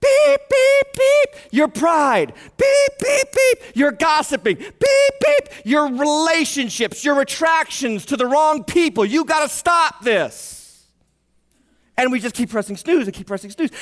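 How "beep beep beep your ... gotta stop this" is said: high loud beeps then a firm warning; alarm clock beeping. Retold or imitated. Preacher voicing God as an alarm clock warning the congregation, loud beeps. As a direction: sound the alarm, then call out each sin. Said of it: "God is like".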